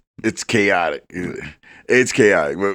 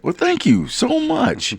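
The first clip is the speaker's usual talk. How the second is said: slow, high, gracious Southern drawl; Southern accent; slower than usual. Retold or imitated. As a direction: thank them like a Southern gentleman. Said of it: "Now it's like".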